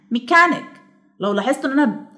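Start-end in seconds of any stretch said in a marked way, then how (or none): 0.1-0.8 ميكانيك climbing to a high كان, then settling; stress on middle syllable. Shown as a demonstration. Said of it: "بيعلى وبعد كده".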